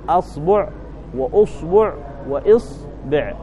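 أصبع said with fatha, then ضمة, then كسرة on the hamza; slowly contrasting the three hamza vowels of أصبع; three vowel variants. Shown as a demonstration. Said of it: "همزة أصبع فيها ثلاث لغات". (نطق أصبع بفتح الهمزة وضمها وكسرها)

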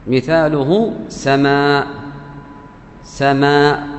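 سماء with the long alif stretched well out before the hamza; drawn-out madd. Shown as a demonstration. Teacher showing students the connected madd in سماء, stretched. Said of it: "عندي مد ألف وبعد الألف همزة".

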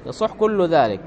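ordinary talk, nothing marked

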